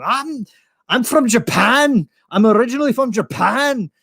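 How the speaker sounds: put-on absurd claim in a big Scottish accent; Scottish brogue. Fake Scot claims Japan to seatmate, hammy Scottish brogue. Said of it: "talking, like, Scottish".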